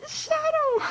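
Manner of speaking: high, excited call to the dog. Real, not acted. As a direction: sing out the dog's name gleefully. Greeting the dog at home.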